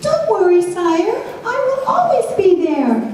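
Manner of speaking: high, squeaky little mouse; tiny mouse voice. Acted. Mouse Edwin comforting the king, high and squeaky. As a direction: pipe it up like a small brave mouse. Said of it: "He whispered".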